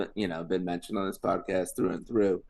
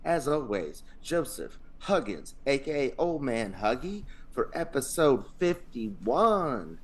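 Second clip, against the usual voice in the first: hype podcast intro; 7 semitones higher than usual. Acted. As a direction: pump up the crowd like a host.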